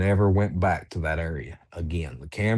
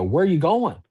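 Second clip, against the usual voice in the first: higher, singled-out calling voice; mimicked call, pitched up; retold creature's question; 9 semitones higher than usual. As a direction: imitate the thing calling from under the ridge. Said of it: "I hear it again go".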